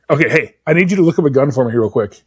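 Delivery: ordinary talk, nothing marked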